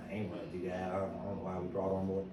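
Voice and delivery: low, soft grumbling complaint; grumbling employee. Retold or imitated. Employee sulking to the boss about a new idea, low and soft. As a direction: mutter it like a staff member who never wanted this.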